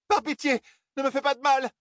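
very high frightened begging; fluttering cries for help. A narrator's reading. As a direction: plead for mercy in panic. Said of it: "cria-t-elle".

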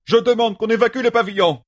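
high-pitched urgent outcry; crying out. A narrator's reading. Magla demands the pavilion be cleared, crying out. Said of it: "s'écria Magla".

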